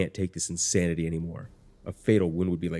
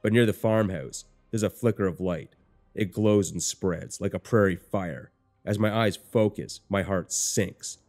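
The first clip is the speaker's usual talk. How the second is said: ordinary delivery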